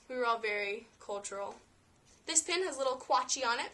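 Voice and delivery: ordinary talk, nothing marked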